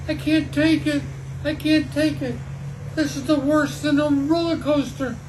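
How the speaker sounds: high, loud frantic complaint; loud, high-pitched cry of overwhelm; shrieking. A narrator's reading. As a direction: shriek it in storybook panic. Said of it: "Sawyer shrieked".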